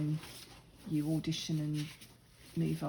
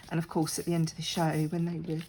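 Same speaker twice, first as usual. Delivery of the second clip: ordinary talk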